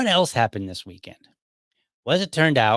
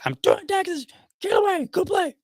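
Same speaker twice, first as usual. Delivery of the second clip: much higher, shrill shouted busy mom; impression of mom. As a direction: shoo the kid away in a squeaky, exaggerated mom voice. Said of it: "That's my best impression".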